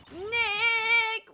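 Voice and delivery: high, loud yell of a name; drawn-out yell. Acted. Companion telling Nick to stop shouting, drawn-out yell. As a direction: stretch the name into a loud protest. Calling out name in game scene.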